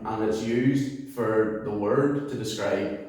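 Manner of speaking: ordinary delivery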